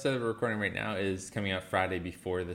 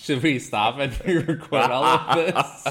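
louder, laughing through the question; 4 semitones higher, 7 dB louder than usual. Real, not acted. Joking to the co-host about re-recording, laughing. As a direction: giggle through the joke.

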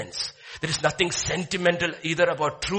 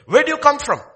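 a hint of a gruff, higher governor's challenge; rough Joseph; 9 semitones higher, 9 dB louder, faster than usual. Joseph questioning his brothers in a rough, loud voice. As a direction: question them like a stern stranger. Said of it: "spoke roughly to them".

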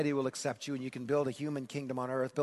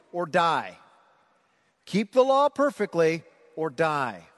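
louder, driving sermon delivery; full preaching; 3 semitones higher, 6 dB louder than usual. Acted. Preacher to the congregation, preaching at full force. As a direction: hammer home look and live.

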